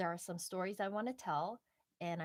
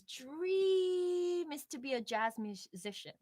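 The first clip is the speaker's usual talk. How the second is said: dramatic emphasis; 6 semitones higher, 4 dB louder, slower than usual. Real, not acted. Dramatizing his dream.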